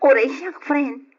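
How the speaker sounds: giggly happy agreement; excited laugh. Acted. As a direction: bubble over with glee at the good news. Friend shares excitement, laughing.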